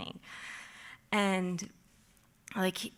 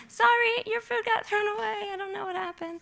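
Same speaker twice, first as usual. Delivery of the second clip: much higher, squeaky sheepish apology; mock apology; 13 semitones higher than usual. Imagining telling the guests the food is gone, squeaky. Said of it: "I'm gonna be like".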